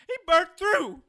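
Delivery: high, loud panicked cries; horrified shouting. Acted. Man screaming in terror at the woman who killed his friend. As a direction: recoil in terror and yell.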